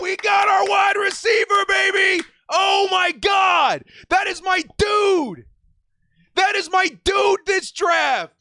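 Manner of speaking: high-pitched real celebration; jubilant shouting. Real, not acted. Fan got his wide receiver, jubilant shouting.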